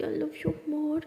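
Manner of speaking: drawn-out whispered reply; slow whisper. Acted. Girlfriend answering at the hospital bed, slow whisper. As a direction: whisper it back, tender and slow.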